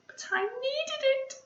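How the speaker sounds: high, small sniffling voice. Acted. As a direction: hold back tears, lightly acted. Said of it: "sniffed Pip".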